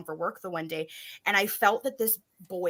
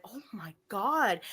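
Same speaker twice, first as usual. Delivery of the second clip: slow, breathless whisper of shock; awestruck, drawn-out whisper; stunned whisper; slower than usual. Retold or imitated. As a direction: whisper it slowly, as if stopped dead. Stunned reaction in retold story.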